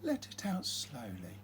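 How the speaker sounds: soft, hushed, easing downward; calming breathing guide. Acted. Mum leading dragon breathing together, soft and calming. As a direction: let the words sink softly with the breath.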